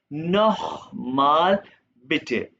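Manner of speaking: नोखमाल with a throaty kh, very slow; German kh sound. Shown as a demonstration. Teacher demonstrates the kh sound in नोखमाल. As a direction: stretch नोखमाल slowly, throaty kh. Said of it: "खे की आवाज आएगा".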